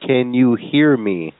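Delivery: slowly, each word set apart; drawn-out, careful question; slow over-enunciation. Pretend. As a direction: ask it word by word for a baffled listener. Clerk does not understand, slows down.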